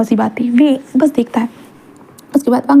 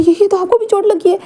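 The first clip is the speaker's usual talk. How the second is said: shocked worry; 8 semitones higher than usual. Acted. Wing sees husband's wound.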